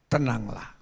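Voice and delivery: low, calming command. Acted. Jesus rises and speaks to the storm, calm command. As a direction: say it deep down in your range. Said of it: "dan Tuhan berkata".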